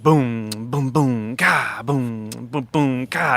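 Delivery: sung-out drum beat in syllables; mouthed drum groove. Shown as a demonstration. Producer showing the song's groove, vocal drum beat. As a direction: imitate a girl-group drum groove by mouth. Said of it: "girl groupie kind of groove".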